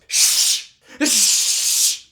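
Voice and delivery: forceful, hammed-up "shh"; loud shushing. Irritated guy at the computer shushing chatty friends, loud mock shushing. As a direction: shush them hard. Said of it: "turns around and just goes".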